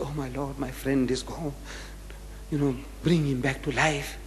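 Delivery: hushed murmured plea to God; silent prayer. Acted. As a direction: pray under your breath, very softly. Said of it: "He's offering up a silent prayer".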